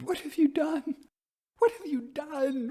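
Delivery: high, startled and distraught; wild frightened cry. A narrator's reading. Elder sister, woken from a nightmare, cries out wildly to her sister. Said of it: "sprang up with a wild cry ... exclaimed".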